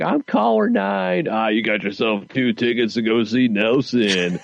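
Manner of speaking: shock jock radio host. Acted.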